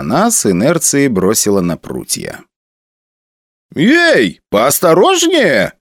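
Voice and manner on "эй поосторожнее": indignant protest. Acted. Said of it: "возмутился Панфилов".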